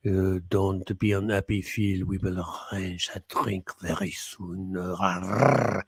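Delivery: slow, low put-on voice; mock consoling. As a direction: placate him in a drawn-out, low mock voice.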